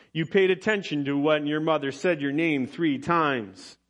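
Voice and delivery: ordinary talk, nothing marked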